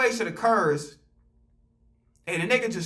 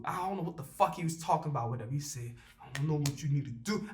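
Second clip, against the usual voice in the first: quiet aside; 7 semitones lower, 11 dB quieter than usual. Retold or imitated. Retelling manager's remarks.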